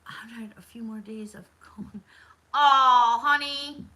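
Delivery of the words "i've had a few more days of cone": whisper. Real, not acted. Reads viewer comment aside.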